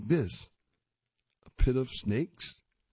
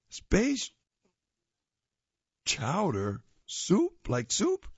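much higher puzzled voice; puzzled friend Sam; 7 semitones higher, slower than usual. Acted. Sam puzzling over space chatter, much higher confused voice. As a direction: mishear it and question, confused.

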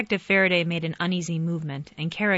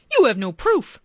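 angry raised shout; 7 semitones higher, 7 dB louder than usual. A narrator's reading. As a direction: shout the protest. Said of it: "shouted the wolf".

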